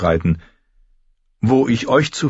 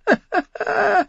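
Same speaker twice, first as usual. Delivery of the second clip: very high giggling cackle; shrill old lord's chuckle; old man's giggle. A narrator's reading. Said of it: "Er kicherte".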